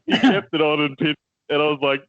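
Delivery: usual speaking voice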